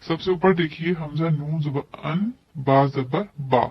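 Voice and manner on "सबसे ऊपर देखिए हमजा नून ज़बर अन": usual speaking voice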